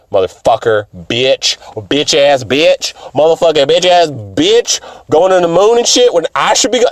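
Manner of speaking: a hammed-up boyfriend cursing out his girlfriend, building up; mock angry rant.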